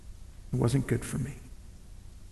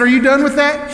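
eager ask; 6 semitones higher than usual. Retold or imitated. Begging daughter for cake.

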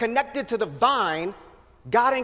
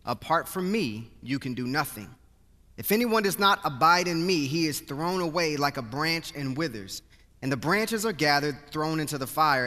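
measured, solemn Bible reading, settling lower at line ends; scripture reading; 6 semitones lower, 4 dB quieter than usual. Acted. Reads scripture before sermon.

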